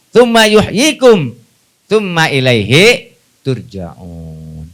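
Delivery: slow recited Arabic, sinking low at the end. Acted. Preacher closes the verse for the congregation, slow and falling. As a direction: intone slowly and let it fall.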